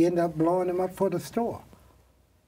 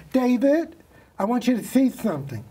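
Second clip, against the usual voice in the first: calling out; 9 semitones higher, 4 dB louder than usual. Retold or imitated. Receptionist calls her boss over.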